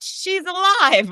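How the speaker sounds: high, slow astonished call; mock yell. Announcer yells to the crowd, high and astonished. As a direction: shout the discovery to the room. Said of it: "And I yell out".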